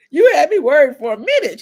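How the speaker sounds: high, loud, laughing through the words; laughing mother. Retold or imitated. Mother teasing her grown daughter, laughing loudly. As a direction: tease her with a big laugh.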